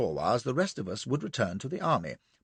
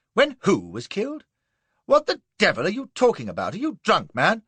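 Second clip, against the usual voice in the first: higher, sharp puzzled outrage; bewildered; 8 semitones higher, 4 dB louder than usual. A narrator's reading. Colonel fakes confusion at the trooper's claim, sharp and bewildered. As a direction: play the baffled officer. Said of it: "frowning, bewildered".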